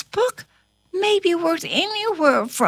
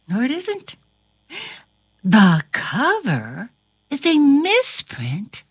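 ordinary delivery

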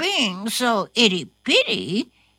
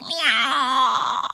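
high mewing cry; cat meow; 5 semitones higher than usual. Acted. Cat cries out for one more snack, high and mewing. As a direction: mew like a small cat.

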